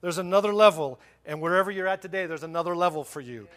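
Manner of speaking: loud, rallying sermon delivery; forceful preaching. Real, not acted. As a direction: drive each item home to the congregation.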